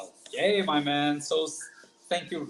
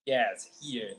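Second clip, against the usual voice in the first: ordinary delivery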